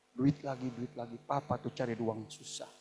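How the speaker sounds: low, softening complaint; lightly acted tired dad; grumbling father. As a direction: grumble wearily at a child wanting money. Acts father grumbling about money.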